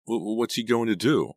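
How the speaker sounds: nervous stammer, voice jumping up. A narrator's reading. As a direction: stumble on the first word in fright. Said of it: "I stammered".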